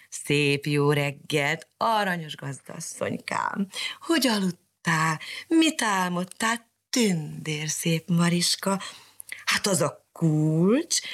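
slow, purring flattery from a sly cat; fawning cat. Acted. Sooty cat sweet-talks Mariska for milk, slow fawning purr. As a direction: drag it out sweetly like a begging cat.

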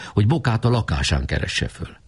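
plain reading voice

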